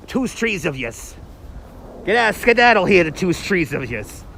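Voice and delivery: put-on tough New York movie voice; movie-line impression. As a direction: quote it like a street tough ordering people off. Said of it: "one of my favorite lines from Out for Justice".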